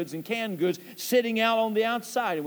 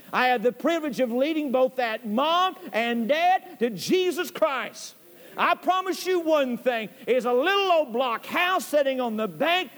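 rising, higher, building preacher's climax; preaching at full force; 6 semitones higher than usual. Acted. Preacher tells the church about heaven in that house, building fervor. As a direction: lift them up toward heaven.

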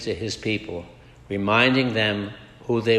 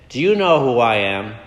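upset; 4 dB louder than usual. Retold or imitated. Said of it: "Now the man was more upset".

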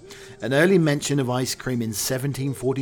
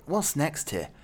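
ordinary talk, nothing marked

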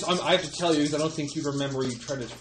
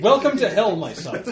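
louder mock-menacing welcome; ominous welcomer; 6 semitones higher, 6 dB louder than usual. Greeting a son on the wild ride, mock ominous. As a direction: open high and loud, then drop down. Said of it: "It's like".

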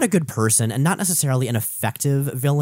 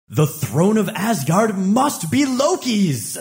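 dramatic declaration; 7 semitones higher, 4 dB louder, slower than usual. Acted. Enchanted Thor declares.

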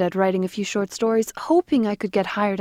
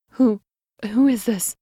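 voice cracking; 3 semitones higher, 4 dB louder than usual. A narrator's reading. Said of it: "my voice cracking".

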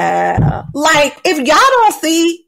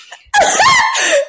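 high shrieking laugh. Real, not acted. Cohost laughing at her own slang mistake, high shriek.

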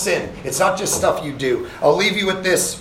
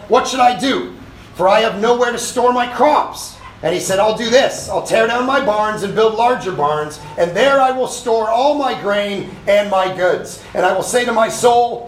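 gloating rich man; 7 semitones higher than usual. Acted. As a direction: crow over your full barns. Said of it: "rich man in the parable".

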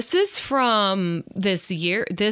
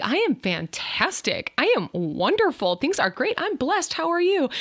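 enthusiastic; 8 semitones higher than usual. Retold or imitated. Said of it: "'cause I'm like".

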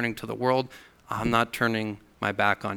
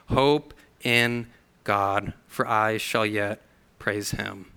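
slow emphatic reading; 3 semitones lower, slower than usual. Shown as a demonstration. Verse's closing line, stressed.

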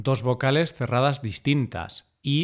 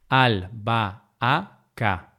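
albahaca broken slowly into al, ba, a, ca; syllable by syllable; much slower than usual. Shown as a demonstration. Teacher dividing albahaca to show the hiato, syllable by syllable. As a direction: say "albahaca" as four separate syllables.

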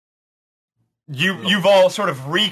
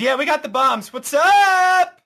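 excited greeting; 12 semitones higher than usual. Acted. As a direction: greet them like heroes. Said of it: "offloads and says".

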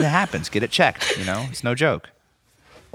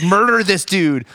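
higher, louder mock command; mock bloodthirsty urging; 5 semitones higher, 4 dB louder than usual. Cohost voicing the girl demanding murder, mock fierce urging. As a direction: urge it on with playful force. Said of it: "the girl's like".

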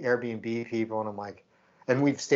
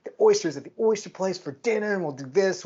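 higher, eager run through the trip plans; bubbly itinerary rundown, pitched up; excited planning; 5 semitones higher than usual. Retold or imitated. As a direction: reel off plans with building excitement. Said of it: "we were so excited to go".

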